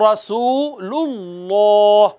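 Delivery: रसूलुल्लाह drawn out with a full, heavy लाम; heavy lam. Shown as a demonstration. Teacher reciting the kalima, heavy lam drawn out slowly. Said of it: "इसी तरह कलमा तैयबा में है".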